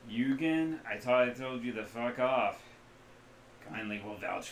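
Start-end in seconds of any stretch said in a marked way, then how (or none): 0.1-2.6 gruff intercom voice. Acted.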